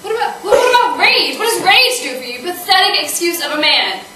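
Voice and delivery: loud, high, scornful rant; furious outburst. Acted. Woman berating a date turned on by anger, furious. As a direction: let rip in disgusted disbelief at full volume.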